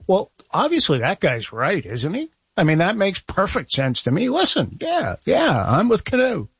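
high, swooping voice of someone totally won over; emphatic agreement. Retold or imitated. Commentator voicing his own reaction to a clear argument, emphatically agreeing. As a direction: act out being convinced, pitch leaping up. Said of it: "I usually find myself going".

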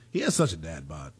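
ordinary talk, nothing marked

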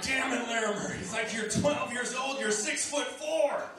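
hammed-up coach scolding his player, building to a yell; lecturing coach. Coach lecturing Larimer about dedication, mock angry rant. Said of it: "And he's like".

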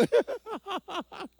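high-pitched burst of laughter. Real, not acted. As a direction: burst out laughing at your own joke. Laughs at his own joke.

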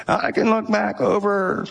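put-on slow it down like an elderly man; old man voice. Pastor imitating an elderly giver to the church, slow old man voice. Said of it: "he talked like this".

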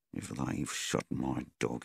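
inner thought. A narrator's reading. Farmer thinking privately about his dog, quiet brooding. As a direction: think it to yourself, low and grim. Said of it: "he thought".

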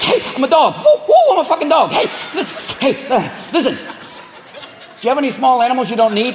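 high, manic fake-dog voice; coyote posing as dog. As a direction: yip and wheedle like a shifty coyote. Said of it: "looking like".

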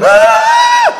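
very high, loud, sustained comic shriek; screaming. Acted. Snorkeler fleeing across water, sustained screaming. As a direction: hold a piercing scream while fleeing. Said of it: "screaming the whole way".

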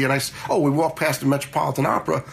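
usual speaking voice

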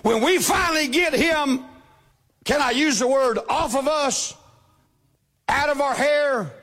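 normal talking voice

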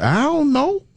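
high squeaky innocent reply, barely held together. Retold or imitated. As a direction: play dumb in a tiny high voice. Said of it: "can't even keep a straight face".

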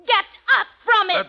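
high, fast furious order; angry command. Acted. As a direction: order him up from the chair. Orders him from chair.

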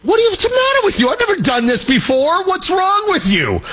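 put-on squeaky teen disbelief at dad; mock-incredulous kid asking what's wrong with dad; imitating his kid. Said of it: "He's looking at me like".

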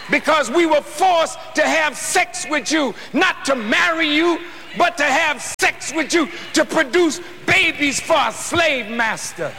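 high, shouting pulpit fire; preaching at full force. Acted. Preacher to congregation, full force shouting sermon.

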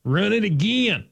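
O-line coach impression. Host answers his co-host's coach bit, hollered Southern. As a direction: echo the call like a fired-up line coach. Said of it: "impersonate offensive line coaches".

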